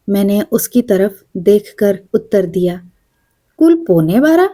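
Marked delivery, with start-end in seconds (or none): 3.6-4.5 higher, rising dismayed question; disappointed. A narrator's reading. Coughing man in the upper berth, mildly let down by the hour. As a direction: let the hope drain out of the question. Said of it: "उसने निराश स्वर में कहा".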